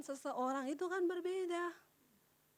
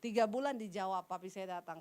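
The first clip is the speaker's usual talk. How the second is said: ordinary delivery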